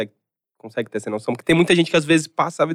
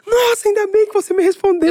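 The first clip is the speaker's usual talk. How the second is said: much higher, gushing fan voice; mock fan voice. Mocking a hater who turns into a fan, high and gushing. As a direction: play a starstruck fan, squealing high. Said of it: "aí a pessoa".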